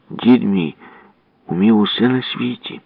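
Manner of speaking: plain reading voice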